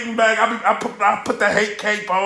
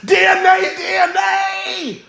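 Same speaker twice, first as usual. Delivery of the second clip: drawn-out dismayed shout; 8 semitones higher, slower than usual. Retold or imitated. Groaning at rapper's mistake.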